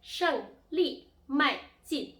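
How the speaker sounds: 胜利迈进 loud and high, each syllable falling sharply; emphatic falling-tone drill on 胜利迈进; fourth-tone demo. Shown as a demonstration. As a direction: punch out 胜利迈进 slowly, one falling syllable at a time. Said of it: "symbol of the falling tone".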